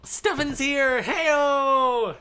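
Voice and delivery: loud, high, drawn-out hype holler; hyped party shout. Pretend. Streamer greeting an arrival to start the party, hyped shout.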